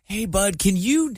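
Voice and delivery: put-on sweet mild plea to a child; gentle ask. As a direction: coax the kid kindly. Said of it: "I would've been like".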